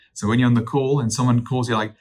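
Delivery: ordinary talk, nothing marked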